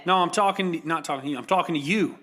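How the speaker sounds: single out the listener in pretend outrage; mock confrontation.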